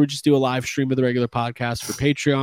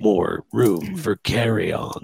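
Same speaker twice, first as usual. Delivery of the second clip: trailer voice; 6 semitones lower, much slower than usual. Acted.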